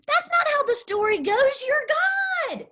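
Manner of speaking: high, pleading protest; upset disciples. Acted. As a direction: beg him not to let it happen. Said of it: "the disciples are like".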